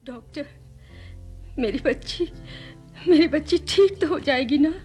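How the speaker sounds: high, worried pleading; anxious mother. Acted. Mother asks the doctor if her child will recover, anxious. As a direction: plead with the doctor, voice lifted in worry.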